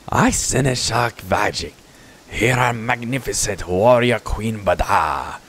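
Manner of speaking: slow, grandiose goblin herald; drawn-out comic goblin announcement; goofy goblin. Acted. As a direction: proclaim it as a silly little creature. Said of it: "kind of a goofy goblin kind of character".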